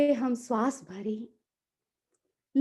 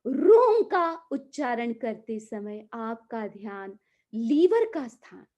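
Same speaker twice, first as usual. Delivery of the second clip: ordinary talk, nothing marked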